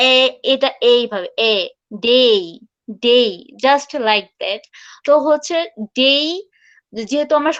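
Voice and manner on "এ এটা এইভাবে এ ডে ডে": "এ" spread with lips pulled wide, then "ডে"; model vowel. Shown as a demonstration. Teacher showing learners her day vowel. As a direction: stretch the lips sideways on "এ". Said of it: "আমার pronunciation হবে".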